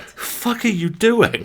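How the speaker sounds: high, slow outraged screech; mock shriek. The cat screeches at the player, high and outraged. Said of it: "She's like".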